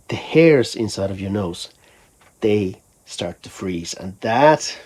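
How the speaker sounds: normal talking voice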